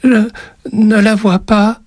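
put-on very high, slow, halting child; timid, drawn-out child's voice; frightened boy. A narrator's reading. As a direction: force the words out as a scared small boy. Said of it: "réussit-il tout de même à dire".

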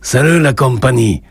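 a hint of a Spanish accent, lightly voiced; accent espagnol léger. A narrator's reading. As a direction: greet the bar with a faint Spanish lilt. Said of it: "avec un accent qui dissimulait mal ses origines espagnoles". (léger accent espagnol, à peine marqué)

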